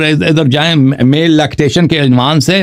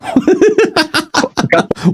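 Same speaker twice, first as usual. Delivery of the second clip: mocking laugh; 12 semitones higher than usual. Real, not acted. Mocking the rival's teacher.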